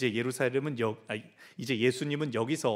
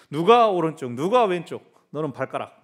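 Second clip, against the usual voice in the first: louder jostling claims; playful disciples' quarrel; mock squabble; 2 semitones higher, 9 dB louder than usual. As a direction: fight over the best seat. Disputing seats.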